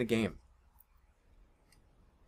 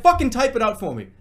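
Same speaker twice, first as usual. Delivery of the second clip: louder, higher fed-up demand; exasperated; 5 semitones higher, 7 dB louder than usual. Retold or imitated. Telling his friend to type it, fed up and loud. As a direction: snap at him to just type it. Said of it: "I was like".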